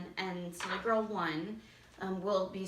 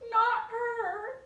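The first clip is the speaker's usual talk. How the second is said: put-on swooning silly girl; 13 semitones higher, 4 dB louder than usual. Shown as a demonstration. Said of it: "with her hands fanning herself".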